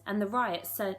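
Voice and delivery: ordinary delivery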